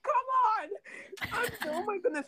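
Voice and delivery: high helpless laughter; squeaky giggling through the words; laughing. Real, not acted. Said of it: "I'm laughing at this".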